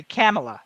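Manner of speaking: "Kamala" as KAM-uh-luh; like camera. Shown as a demonstration. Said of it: "like, almost like camera".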